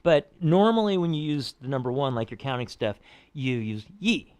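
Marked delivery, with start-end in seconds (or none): 4.0-4.3 YI falling sharply; sharply descending YI; fourth tone. Shown as a demonstration. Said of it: "sharply descending fourth tone".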